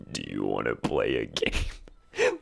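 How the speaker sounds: creepy horror-movie villain; Saw villain voice. Retold or imitated. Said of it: "like, Saw".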